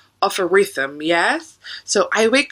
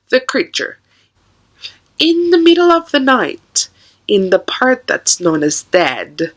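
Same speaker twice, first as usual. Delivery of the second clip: poem recitation; 4 semitones lower, 3 dB louder than usual. Acted. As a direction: recite it darkly, pausing after each line.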